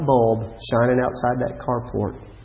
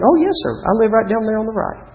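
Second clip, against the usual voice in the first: put-on much higher, quick kid's reply; eager child voice; 9 semitones higher than usual. Tweety assures the coach, high and fast. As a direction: chirp it fast like an eager girl. Tweety insists she lives there.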